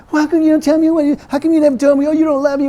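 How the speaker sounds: high, fast whiny pouting; pouting Delilah. Retold or imitated. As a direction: sulk like a nagging girlfriend. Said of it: "she was just pouting".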